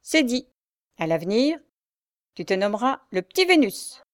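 ironic tone. A narrator's reading. Bully mocking Pierre with a new nickname, ironic. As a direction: hand down the nickname with irony. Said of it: "d'un ton ironique".

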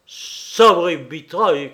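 loud, drawn-out angry oath; furious shout. A narrator's reading. King raging at insolent Pierrot, furious shout. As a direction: shout it slowly in a rage. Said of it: "cria-t-il".

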